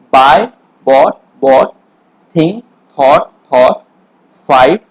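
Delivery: loud, clear call-out of each verb form; teacher's drill voice for shadowing practice. Shown as a demonstration. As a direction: recite for students to repeat after. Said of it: "मैं बोलूँगा मेरे साथ आपको भी video pause करके shadowing करना है".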